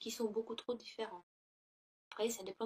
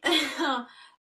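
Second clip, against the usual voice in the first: loud burst of laughter; 3 semitones higher, 12 dB louder than usual. Real, not acted. Laughs at her makeshift webcam setup.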